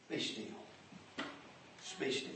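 soft, hushed "Be still". Acted. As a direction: bring it down to a hush. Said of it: "repeats God's words softly".

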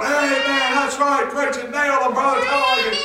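high, loud mock amen-corner cheering; shouting each other on. Preacher imitating church folk cheering the preaching, loud egging-on shouts. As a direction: egg the preacher on with hearty shouts. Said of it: "we'll shout each other on".

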